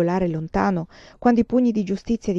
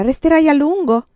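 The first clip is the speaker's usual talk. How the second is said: loud, high, prying question; shouted question; 8 semitones higher, 8 dB louder than usual. A narrator's reading. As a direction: call it out over the others. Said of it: "gridò un'altra". (domanda gridata con voce acuta)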